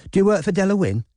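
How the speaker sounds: high, bright, eager question; bubbly posh girl voice; chirpy posh voice. Acted. Robin's put-on chirpy persona.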